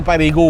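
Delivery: parigú said the French way, pa-ri-GÚ with stress on the end; Parisian pronunciation. Shown as a demonstration. An old bricklayer showing how Parisians say parigú. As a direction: give parigú a Parisian lilt, stress on the last syllable. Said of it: "hablo con acento parisino".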